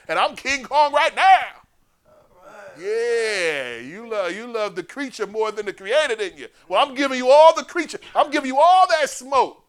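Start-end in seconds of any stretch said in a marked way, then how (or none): 0.1-1.4 high and very loud boast; hammed-up movie boast; Denzel's King Kong line. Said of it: "I love that part". 4.8-9.7 high and very loud shout; a preacher at full blast; full-force preaching. Acted.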